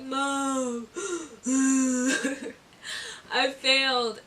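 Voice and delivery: imitated tearful call to mom; mock crying. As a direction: act out sobbing between the words. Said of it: "and I'm just crying".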